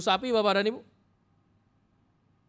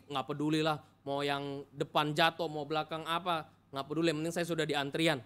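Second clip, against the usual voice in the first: soft, fast, couldn't-care-less muttering; careless shrug; 3 semitones lower, 8 dB quieter than usual. Pretend. A churchgoer mocking indifference, quiet and dismissive. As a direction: brush everyone off under your breath.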